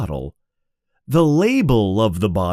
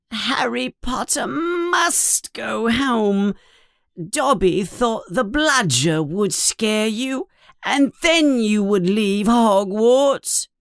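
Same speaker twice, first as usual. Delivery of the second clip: high, squeaky elf voice, sad and pleading; pleading house elf; 11 semitones higher than usual. Acted. Dobby the house elf pleads with Harry, high and squeaky. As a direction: plead with him like a worried little elf.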